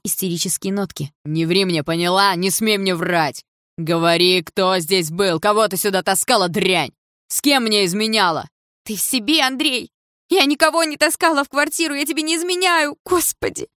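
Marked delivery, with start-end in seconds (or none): 1.3-8.5 loud, furious accusing yell; angry shout. A narrator's reading. Stepfather accuses mother, quarrel. 8.8-13.7 much higher, loud desperate shout; shrill denial. A narrator's reading. Mother denies, shouting back.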